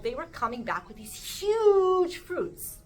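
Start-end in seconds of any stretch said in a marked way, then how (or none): 1.4-2.2 huge drawn way out, high and loud; stretched HUGE. Acted. Describing the fruits the scouts brought back, huge drawn out high. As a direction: stretch "huge" long for sheer size.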